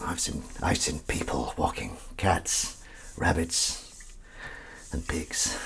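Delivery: slow, hushed movie-trailer whisper; film trailer voice. Shown as a demonstration. Telling viewers about pet walks like a film trailer. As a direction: make it sound like a film. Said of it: "I tried to make that sound like a film".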